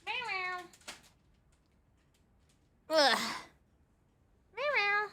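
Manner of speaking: playful meows. Pretend. Meowing at cat.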